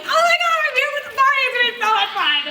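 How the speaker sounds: very high-pitched hyper cheerleader gush; pumped up. Shown as a demonstration. Coach showing consultants how to greet a party, hammed-up excitement. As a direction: squeal it with over-the-top party hype. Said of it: "pumped up, like".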